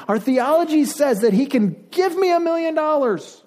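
high-pitched, insistent preaching plea; impassioned rant. Acted. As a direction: pitch it way up, hammering each claim. Ranting demand for a miracle God.

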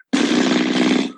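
low, buzzing cartoon raspberry; imitated hecklers' low drawn-out jeering buzz; imitated booing noise. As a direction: blow a long mocking raspberry like a cartoon heckler. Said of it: "put their hands on their nose and just went".

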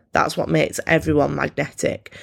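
ordinary talk, nothing marked

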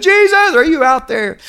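put-on high child's voice calling up to the sky; little boy calling out. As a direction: call out to the stars like a small boy. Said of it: "this little boy looked up in the sky and said".

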